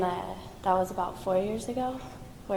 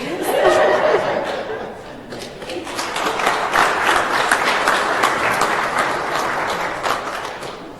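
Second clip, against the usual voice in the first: long laugh; 10 semitones higher, 8 dB louder than usual. Real, not acted. Laughs after joke about dad.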